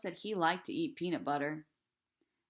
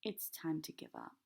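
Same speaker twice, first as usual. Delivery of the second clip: softer, gentle and resigned; real soft voice; 2 semitones lower, 7 dB quieter than usual. A narrator's reading. Preacher gently telling his daughter to stop, softly. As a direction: let it down softly. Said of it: "in a real soft voice".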